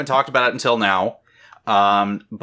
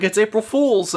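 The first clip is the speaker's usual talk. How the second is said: voicing Jake; 12 semitones higher than usual. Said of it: "He's like".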